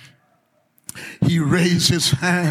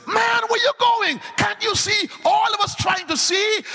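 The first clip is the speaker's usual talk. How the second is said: much higher shouted complaint from the crowd; annoyed crowd voice. Acted. Crowd member shouting at the man pushing through, annoyed. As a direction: bark it back like a jostled onlooker.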